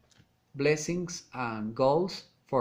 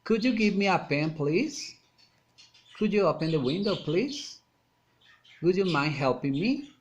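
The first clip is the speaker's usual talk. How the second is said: requests climbing high on "please" and final "me"; rising request intonation; 3 semitones higher than usual. Shown as a demonstration. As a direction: lift "please" and the closing "me" upward. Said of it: "rising intonation".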